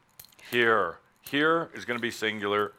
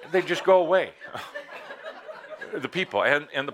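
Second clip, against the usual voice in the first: chuckling through the words; laughing; 7 semitones higher, 4 dB louder, faster than usual. Real, not acted. Speaker cracking up at the people-have-died joke, laughing. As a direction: laugh while finishing the joke.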